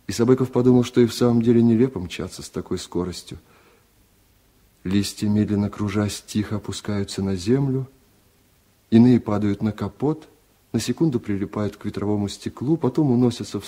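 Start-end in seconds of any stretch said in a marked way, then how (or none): none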